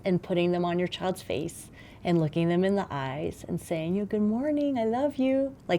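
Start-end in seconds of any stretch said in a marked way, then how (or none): 4.1-5.5 put-on higher, tender good-morning to a small child; tender parent greeting. Parent greeting a small child at waking, tender and higher. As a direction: say it like cupping a child's face.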